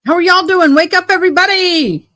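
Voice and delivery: high, loud wake-up call. Real, not acted. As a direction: rouse a sleepy crowd. Rousing sleepy viewers.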